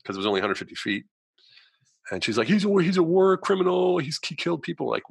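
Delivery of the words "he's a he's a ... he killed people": high, loud wailed accusation; hammed-up shrill denunciation; mock accusing cry. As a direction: imitate a hysterical girlfriend denouncing him. Girlfriend shrieking accusations to police.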